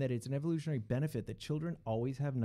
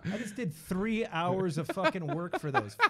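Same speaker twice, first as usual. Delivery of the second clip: mock angry rant; 5 semitones higher, 6 dB louder than usual. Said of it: "I'm like".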